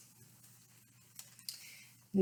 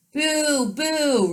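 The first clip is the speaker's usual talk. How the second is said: high, louder booing; shouted boo; 10 semitones higher, 7 dB louder than usual. Acted. Raggedy Andy shouts at the king, high loud boos. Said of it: "Raggedy Andy shouted at the king".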